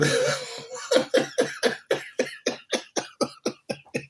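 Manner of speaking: long shrill laughter; high laugh. Real, not acted.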